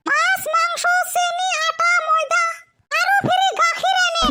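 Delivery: high-pitched scolding wife voice; a wife nagging her husband to go shopping. Acted. Wife scolds husband about the in-law's visit, high and nagging.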